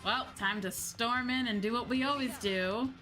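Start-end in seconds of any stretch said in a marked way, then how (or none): none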